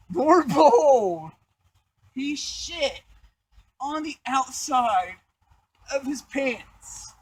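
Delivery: high, drawn-out storyteller's punchline; dramatic reveal. Real, not acted. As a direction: stretch each phrase out in mock amazement. Dramatic reveal of the gross climax.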